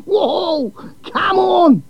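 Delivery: egging on the match like a fan; brash Aussie cheer. A narrator's reading.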